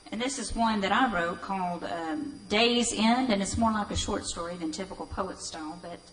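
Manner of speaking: ordinary talk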